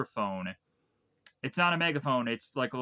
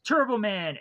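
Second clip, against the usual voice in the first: higher-pitched, mildly acted excitement; mock excited exclamation; 8 semitones higher, 4 dB louder than usual. Myron and Howard look to one another, mock excited. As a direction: exclaim it like a retold sudden realization. Retold excited realization by two shoppers.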